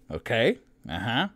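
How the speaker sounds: cheerful turn to the next one; lightly voiced half-orc woman.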